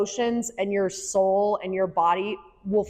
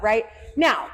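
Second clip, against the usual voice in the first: ordinary talk, nothing marked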